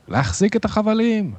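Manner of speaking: high-pitched captain's shout; shouted order. A narrator's reading. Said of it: "צעק רב החובל". (צעקת פקודה גבוהה)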